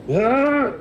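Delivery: high loud battle yell; battle cry. Acted. Dahlin swinging at a rock monster, high yell. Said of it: "and he goes".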